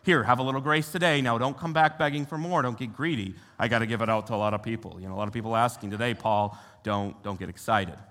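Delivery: a hint of a grudging shopkeeper, hurrying the middle; stingy rationing God. Preacher voicing God as a stingy rationer, lightly grudging. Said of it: "he's got ration cards and he's like".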